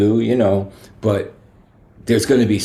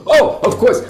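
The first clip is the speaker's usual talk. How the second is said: high, louder sudden give-in; flustered gate agent; 14 semitones higher, 4 dB louder than usual. Retold or imitated. Gate agent suddenly caving to [name], high and louder. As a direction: cave in all at once, high and bright. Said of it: "she goes".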